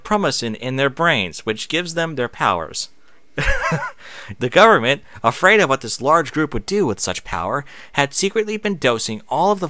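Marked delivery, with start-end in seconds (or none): 3.4-4.4 a quick high laugh breaking off the reading; amused laugh. Real, not acted. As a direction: laugh at the silly word. Laughing at the odd wording.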